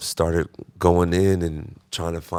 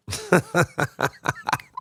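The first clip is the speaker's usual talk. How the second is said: high cackling villain laugh; evil laugh; 10 semitones higher than usual. Acted. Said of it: "That's my evil laugh".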